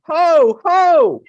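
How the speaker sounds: loud, high ho ho chant; jolly ho ho ho. Shown as a demonstration. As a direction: shout out a jolly ho, ho. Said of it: "repeat after me".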